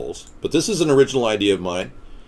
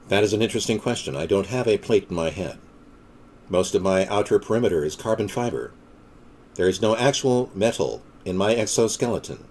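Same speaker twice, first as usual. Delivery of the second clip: lower, softer, lightly robotic deadpan; a hint of a machine explaining itself; mock android; 4 semitones lower, 5 dB quieter than usual. Host answering about a plate in the head, lightly robotic.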